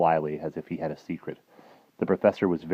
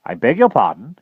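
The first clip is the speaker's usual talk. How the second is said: pointed admiral; 6 semitones higher, 8 dB louder than usual. Acted. Admiral challenges the remark.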